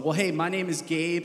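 ordinary talk

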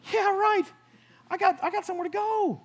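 high, scoffing dismissal; sarcastic brush-off. Retold or imitated. Thinking back at God in traffic, high scoffing brush-off. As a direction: wave it away, you're busy.

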